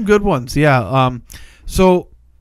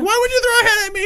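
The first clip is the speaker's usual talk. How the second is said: much higher and fast, hammed-up indignant woman; mock high voice; faster than usual. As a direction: squeak it out like a mock outraged heroine. Woman voiced in retold scene.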